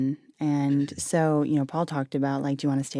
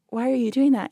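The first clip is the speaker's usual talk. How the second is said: puzzled question; 7 semitones higher than usual. Retold or imitated. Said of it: "I'm like".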